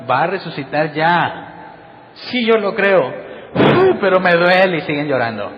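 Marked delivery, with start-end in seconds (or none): 2.1-4.8 mock whiny lament. Mocking weepy disciples.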